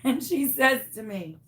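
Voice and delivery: ordinary delivery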